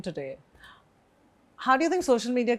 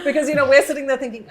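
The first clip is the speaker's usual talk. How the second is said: laughing; 5 semitones higher, 5 dB louder, much faster than usual. Real, not acted. Laughing while explaining women.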